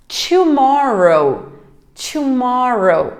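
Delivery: "tomorrow" drawn out slowly, clearly; model tomorrow. Shown as a demonstration. Teacher models "tomorrow" slowly to students. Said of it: "teacher models tomorrow".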